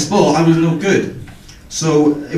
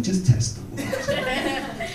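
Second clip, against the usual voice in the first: high, hushed, slow and teasing; tempting inner whisper; 11 dB quieter, much slower than usual. Retold or imitated. His heart tempting him to test the teacher, teasing whisper. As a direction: lure yourself into mischief. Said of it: "my heart was saying".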